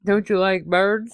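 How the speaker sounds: mock Kirk accent on a rising question; Kirk's odd accent. Host mimics Kirk dismissing the bird report, mock accent. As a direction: brush it off in a hammed-up Kirk voice. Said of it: "why Kirk has that accent".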